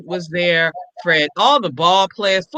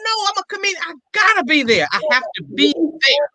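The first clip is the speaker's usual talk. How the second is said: thrilled; 11 semitones higher than usual. Retold or imitated. Own thrilled reaction, pitched high.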